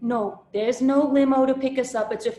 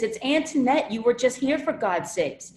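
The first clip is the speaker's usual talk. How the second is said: ordinary delivery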